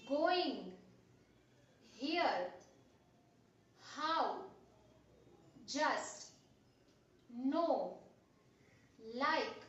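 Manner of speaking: one crisp word at a time, long pauses between; word-list dictation. Shown as a demonstration. Teacher reads out sight words for pupils to revise, word-list dictation. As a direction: say each word separately with a falling tune.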